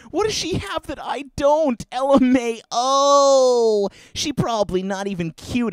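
high, sneering taunt; scorned ex taunting him, high sneering voice; mocking high voice. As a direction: jeer at the new girlfriend.

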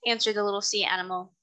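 ordinary delivery, nothing marked

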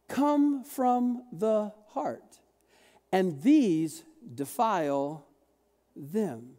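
very slow, weighty scripture line; emphatic slow reading. Shown as a demonstration. Preacher landing the key verse for the congregation, very slow. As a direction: stretch out each word of the key verse. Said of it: "oh, here it is".

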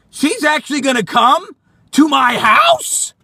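high, rising disbelief; incredulous alarm. Acted. Man learns stalker is visiting, incredulous. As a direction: blurt it out in disbelief, climbing on "house".

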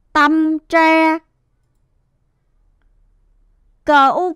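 drawn-out sounding out, then the whole word; slow spelling aloud. Shown as a demonstration. Teacher has pupils read after her, spelling slowly and evenly. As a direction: model each syllable slowly for repeating. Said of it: "Các em hãy đọc theo cô nào".

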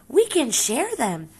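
calm the bully down sweetly; friendly suggestion. Acted. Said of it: "suggested the friendly ladybug".

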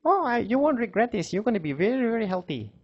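kindly tree, lifted pitch; cheerful tree voice. Acted. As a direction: sound delighted to have a guest. Tree welcomes squirrel.

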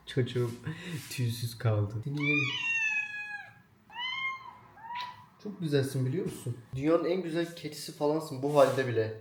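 soft, doting voice for a pet; talking to a cat. Real, not acted. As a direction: coo lovingly at your cat.

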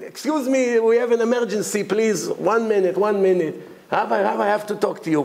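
acted urgent plea, pitched high; urgent interruption. Rabbi and president breaking into his lecture, urgent. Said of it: "they broke into the lecture".

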